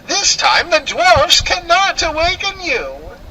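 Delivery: high witchy sneer; triumphant witch voice, pitched high; wicked queen. Acted. Queen taunting the lifeless girl, high witchy sneer.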